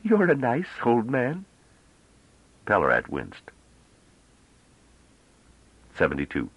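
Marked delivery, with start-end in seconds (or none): 0.0-1.5 put-on slow, playful, teasing girlish voice; giggly tease. A narrator's reading. Bliss teasing Pelorat, slow and playful. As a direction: tease the old man, drawn out and coy. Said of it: "Bliss giggled".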